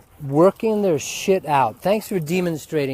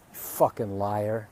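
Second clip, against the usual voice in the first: ordinary delivery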